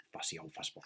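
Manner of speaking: very soft, voiceless aside; under the breath. A narrator's reading. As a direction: whisper it as a private aside. Refrain repeated under the breath.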